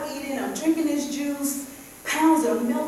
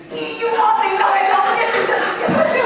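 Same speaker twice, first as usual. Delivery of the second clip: higher and louder mock argument with herself; both sides of a hammed-up quarrel, higher and loud; shouting match; 10 semitones higher, 9 dB louder than usual. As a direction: yell back and forth at a candy bar. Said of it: "I had a shouting match with a Snickers bar".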